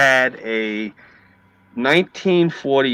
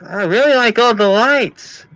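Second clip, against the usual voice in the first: high, loud, drawn-out female voice; gushing woman impression; 10 semitones higher, 4 dB louder, slower than usual. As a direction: gush slowly in a high woman's voice. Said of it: "she goes like this to me".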